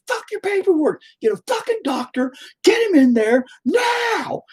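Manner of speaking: high screechy re-enacted yell; furious outburst. Father refusing paperwork for bleeding son, high frantic yell. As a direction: scream the demand, dragging out the last word. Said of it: "I was just pissed".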